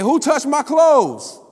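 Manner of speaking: high, slow, loud call across the crowd; Jesus calling out loud. Acted. Jesus calls out to the thronging crowd, loud and slow. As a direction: call it out over the crowd, drawn out. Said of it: "He didn't say it quietly. It was over".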